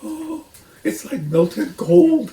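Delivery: high, slow gasping wonder. A narrator's reading. Badger gasps after drinking the cider. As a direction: gasp it out in bliss. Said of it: "he gasped".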